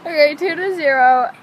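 laughing through the words; trying not to laugh; stifled giggles. Real, not acted. Laughing after losing round.

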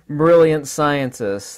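loud, coming out of a laugh; amused sarcasm. Real, not acted. Lecturer jibing at theorists, laughingly sarcastic. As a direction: say it through the tail of a laugh. Finishes the jibe after laughing.

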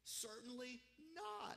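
"Certainly not" drawn out slowly at high pitch; emphatic no. Acted. Preacher voicing Paul's answer on sin, emphatic "Certainly not". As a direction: stretch "Certainly not" high and slow as a firm refusal. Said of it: "that's a pretty emphatic no".

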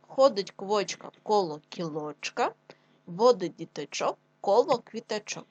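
slow, clear tongue twister for class; slow dictation pace; slow choral reading. Shown as a demonstration. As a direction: read it slowly, every sound clear. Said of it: "Давайте разом".

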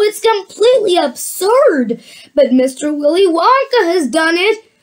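usual speaking voice

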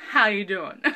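a light take on her signature drawled greeting; a hint of the talk show host's "How you doin'"; Wendy Williams impression. A light hint of Wendy Williams' catchphrase to a viewer named Wendy. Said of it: "what's her face? Wendy Williams".

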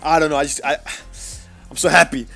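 ordinary delivery

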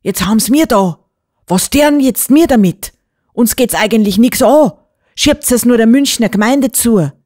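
loud, high-pitched dialect complaint; exasperated mayor. A narrator's reading. Mayor refusing responsibility for the girl, loud and exasperated.

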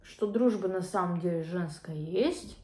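chewing while talking; talking through a mouthful; mouth full of food. Real, not acted. Seen 2: Bites chicken at 1.9s, then talks with puffed, full cheeks.

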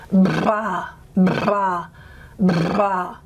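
lips buzzing on bra; bilabial trill. Shown as a demonstration. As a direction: vibrate the lips through bra. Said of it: "Capital B".

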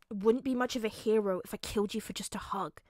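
plain reading voice

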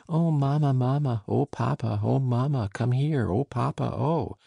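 lightly voiced howl for mamma and papa; frightened cry. A narrator's reading. Children calling out to their parents in mild fright. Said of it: "and then a cry".

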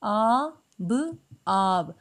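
each sound held apart very slowly, then joined; a teacher slowly blending for young pupils; slow phonics blending. Shown as a demonstration. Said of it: "वो उसकी आवाज़ कैसे होगी".

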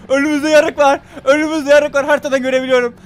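high, loud repeated warning; frantic yell on sighting danger; panicked shouting. Real, not acted. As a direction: shout the alarm over and over at high pitch. Alarm at trench on map.

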